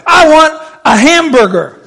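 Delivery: hammed-up insistent demand; childish demand. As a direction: play a crowd fixated on food. Mocking crowd fixated on food.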